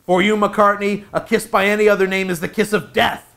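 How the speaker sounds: hammed-up dramatic menace; melodramatic threat. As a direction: proclaim it like a stage villain.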